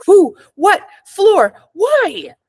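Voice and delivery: flustered panic. Acted. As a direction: sputter each word in shocked confusion. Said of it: "My brain stuttered".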